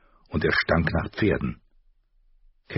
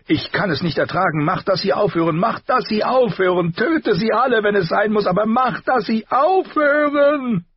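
hysterical screaming, building; 5 dB louder than usual. A narrator's reading. Caitlin can't bear the howling, building to a hysterical scream. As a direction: climb higher and louder until she breaks on the last words. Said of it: "schrie sie".

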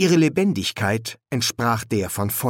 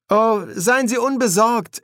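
high, airy reassurance; casual; 12 semitones higher, 4 dB louder than usual. A narrator's reading. Adam reassures the frazzled officer, casual. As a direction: brush off the worry lightly. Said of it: "erwiderte Adam lässig".

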